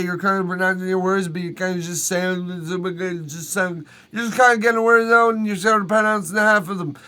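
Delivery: a touch of slurred, half-pronounced mumbling; lazy mock mumble; mumbled words. Shown as a demonstration. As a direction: chew on the words lightly. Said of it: "It's kinda like that".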